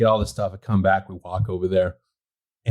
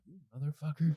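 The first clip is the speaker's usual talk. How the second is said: muttered curse; 4 semitones higher, 12 dB quieter than usual. Retold or imitated. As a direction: mutter it in disbelief. Realizing neighbor isn't military.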